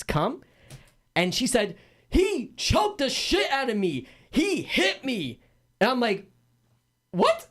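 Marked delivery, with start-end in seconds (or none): 2.1-5.4 slow, high-pitched wail of complaint; mock accusation. Storyteller imitating houseguest accusing him to police, high and slow. 7.1-7.5 very high, incredulous yelp; mock disbelief. Storyteller replaying shock at false accusation, high incredulous yelp.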